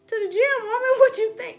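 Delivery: high mock husband voice; squeaky imitation of her man.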